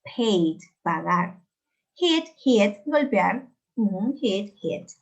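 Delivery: loud, isolated words with pauses between; pronunciation drill. Shown as a demonstration. Teacher modelling irregular verbs for students to repeat, pronunciation drill. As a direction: model each verb pair clearly for repetition. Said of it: "Yo los voy a pronunciar".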